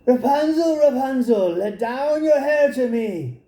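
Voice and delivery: high witchy call up the tower; witch calling. Acted. Enchantress calling to Rapunzel in the tower, high witchy call. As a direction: sing it out high like a cunning witch. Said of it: "he heard how she cried".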